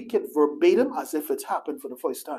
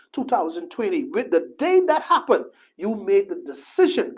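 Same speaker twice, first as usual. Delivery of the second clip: ordinary talk, nothing marked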